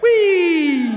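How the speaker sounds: high, loud gleeful whoop; joyful whee. Real, not acted. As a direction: let out a giddy cheer. Performer bursts out of character.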